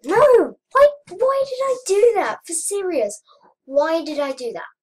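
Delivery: loud, high wail of regret; anguished yelling. Real, not acted. Gamer blaming themselves for a fatal mistake, anguished yelling. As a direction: yell in dismay, easing a little by the end. Own mistake ends the game.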